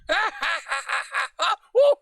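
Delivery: high shrill mocking laugh of the fox. The fox laughs at the drunk rabbit, high cackle. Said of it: "He was laughing".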